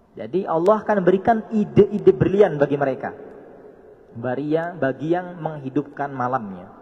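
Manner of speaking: loud, rousing sermon delivery; forceful preaching. Acted. Preacher rousing the congregation, loud and fervent.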